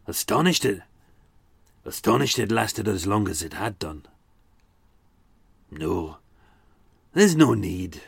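put-on subdued Glaswegian accent; lightly voiced Glaswegian, the rage dropped; Alexander Moyer. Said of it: "this is my Alexander Moyer impression".

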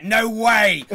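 high, loud mock exclamation; imitated outburst. Co-host retelling phone helper's shock at spaghetti, mock outburst. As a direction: belt it out as her outraged reaction. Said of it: "she was like".

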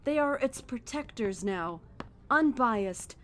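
normal talking voice

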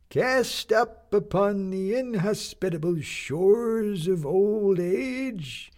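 a doddering captain addressing his shipmates; creaking old sea captain. Acted. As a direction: creak out each word like old ship timbers. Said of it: "in a voice that creaked like timbers".

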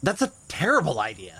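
a mild shout of disapproval. Acted. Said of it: "You don't have to shout".

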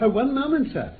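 a sudden high, loud call to stop him. Acted. Holmes calls Lord Cantlemere back at the door, high and loud. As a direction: catch him before he leaves the room. Holmes stops the departing lordship.

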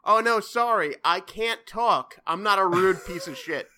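fake polite phone excuse. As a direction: put on an exaggerated polite brush-off. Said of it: "so I could pick up and be like".

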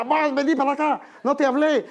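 high, loud curt command; harsh order at home. Retold or imitated. Husband ordering his wife around at home, loud high snap.

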